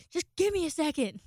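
snappy mock yell, pitched high; retold yell. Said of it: "you yelled again".

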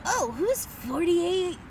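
high, lightly voiced curious innocent; mild naive-sounding imitation; William H. Macy's character. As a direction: ask with mock wide-eyed wonder. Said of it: "William H. Macy is like".

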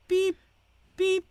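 high, short beep; high-pitched machine blip; imitated electronic beep. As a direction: voice a tracker's beep. Imitates tracker beeping.

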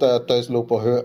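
usual speaking voice

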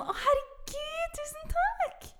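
very high, slow, gushing fake thanks; affected gratitude. Disappointed receiver faking joy over a gift, affected. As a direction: squeal softly in overdone mock delight. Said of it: "det blir så tilgjort".

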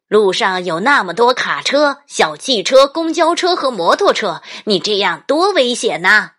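loud, rising lecture on danger; scolding dad. Acted. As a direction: build up the scolding. Dad scolds runaway child.

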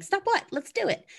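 high, bouncy dog voice; cheeky dog voice. Pretend. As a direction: voice the dog that ignores the telling-off. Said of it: "he would be like".

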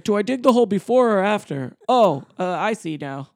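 put-on high excited kid voice, loud; mock Russell. Retelling Russell in the woods.

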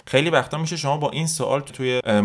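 normal talking voice

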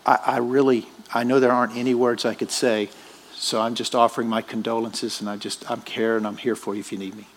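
hushed sympathy to a mourner; soft condolence. Retold or imitated. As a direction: speak gently to a grieving friend. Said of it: "I'll say".

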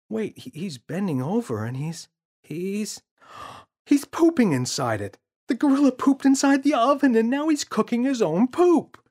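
put-on shocked boy. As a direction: kid horrified at what he sees. James shocked by gorilla.

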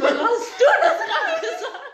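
high, loud incredulous outburst, laughing; mock disbelief. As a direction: replay your shock in a high squawk. Retells own stunned reaction.

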